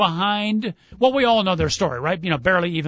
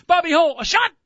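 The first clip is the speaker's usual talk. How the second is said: excited radio goal call, pitched up; hockey play-by-play call; 8 semitones higher, 4 dB louder than usual. Retold or imitated. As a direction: call the shot like an old hockey announcer. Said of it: "Remember that great pause he would have?".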